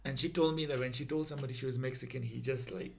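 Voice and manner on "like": ordinary talk, nothing marked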